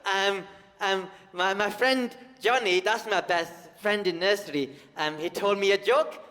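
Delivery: put-on high, eager little-kid voice; imitating small nephew. Comic voicing his nephew begging to tell a joke. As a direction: play an excited nursery kid begging. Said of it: "and he goes".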